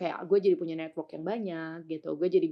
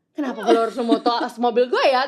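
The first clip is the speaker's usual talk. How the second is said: laughing retold question; 5 semitones higher, 11 dB louder than usual. Husband's puzzled question, retold laughing.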